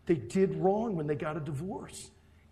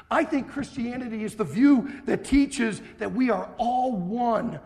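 loud, driving pulpit delivery; forceful preaching; 5 semitones higher, 7 dB louder than usual. Acted. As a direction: preach it hard to the hall. Preaching on marriage to congregation.

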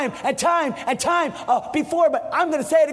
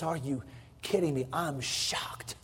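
whispered, much softer and lower; hushed disbelief; 10 semitones lower, 12 dB quieter than usual. Acted. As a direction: breathe it as stunned whisper. He looks back at you and says.

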